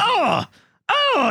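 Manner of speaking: very high, hammed-up wail of oh; retold upset. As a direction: squeal it like an overwrought fan. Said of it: "I got bent out of shape".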